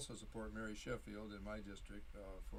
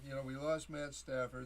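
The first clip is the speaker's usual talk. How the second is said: normal talking voice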